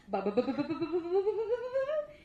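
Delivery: rapid babble climbing steadily in pitch; non-stop babble. Retold or imitated. Mimicking the actor's non-stop patter, rising babble. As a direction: imitate someone rattling on without stopping. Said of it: "he just goes non-stop".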